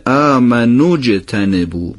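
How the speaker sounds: slow, آمنو linked smoothly into اجتنبو; joined reading. Shown as a demonstration. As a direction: run آمنو straight into اجتنبو. (آمنو اجتنبو پیوسته و آرام)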